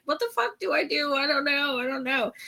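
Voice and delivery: high, soft and fast, reliving the panic; mock panic. Host retelling frantic messages to co-host Ron, hushed fast panic. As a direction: rattle it off in a hushed fluster.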